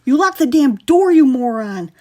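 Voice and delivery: loud, high angry outburst; big brother furious at the locked door; furious growl. A narrator's reading. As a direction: blame him hard. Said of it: "growled at his brother".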